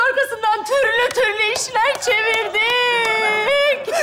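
slow, drawn-out tearful confession; hammed-up mourning cry; wailing lament. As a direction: wail it out, stretching the last word. Mock mourning over living man.

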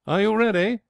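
high, rising call; gleefully ready to go; eager warning. A narrator's reading. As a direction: sing out a countdown question. Mifflin counts down to capsize.

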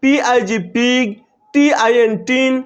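letters slowly spelled, then the word; slow classroom spelling; slow spelling demo. Shown as a demonstration. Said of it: "এই জন্য এটা হয়েছে কি".

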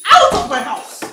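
shrieking order to leave; furious screaming command; furious shout. Acted. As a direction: yell her out of the house. Wife throws sister out.

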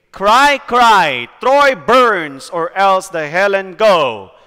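"Cry, cry, Troy burns" punched loud, every word stressed; loud, emphatic spondee on "Cry, cry"; stressed verse reading. Shown as a demonstration. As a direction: read it with heavy beats on "Cry, cry, Troy burns". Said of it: "stressed marks".

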